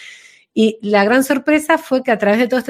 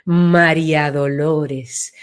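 lower, slow, wide open voice; a broad, grounded, unhurried name; broadened voice; 6 semitones lower, much slower than usual. Shown as a demonstration. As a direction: let the name spread out deep and slow. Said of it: "se ensancha".

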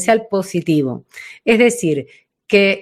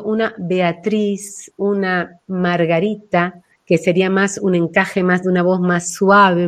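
ordinary talk, nothing marked

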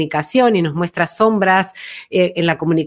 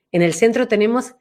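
ordinary talk

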